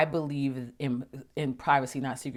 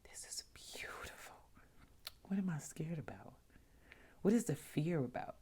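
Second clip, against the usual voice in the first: awed whisper; 13 dB quieter than usual. Retold or imitated. Up on the parasail, whispering to herself in awe. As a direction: breathe it out in a hushed whisper. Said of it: "I was like".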